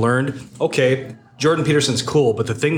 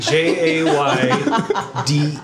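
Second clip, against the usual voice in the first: mock ad spelling; slow spelling; much slower than usual. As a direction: spell it out slowly like an ad voiceover. Spelling the fake code.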